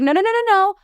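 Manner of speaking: quick, high-pitched flurry of no's; retold panicked denial; flustered protest. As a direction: wave off the accusation in a rush. Denying the mother-in-law's accusation.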